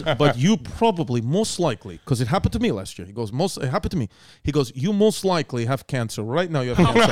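a low imitation of a Russian mover in a Russian accent; mock Russian mover. Mover claiming he has cancer.

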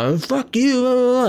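high, hammed-up yell of a raging driver; loud retold tirade, pitch way up; mock screaming. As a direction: shout back over them, imitating a ranting man. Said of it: "The guys just keep screaming at me".

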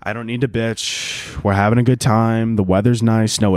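ordinary talk

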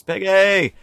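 high, loud yell; mock yell. Friends hailing the departing boats, high mock yell. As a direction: call the name out across the water. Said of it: "we yelled at the boats".

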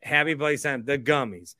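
ordinary delivery

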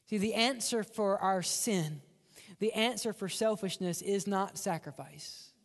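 ordinary delivery

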